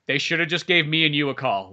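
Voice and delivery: normal talking voice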